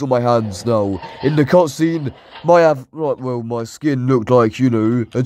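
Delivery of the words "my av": ordinary talk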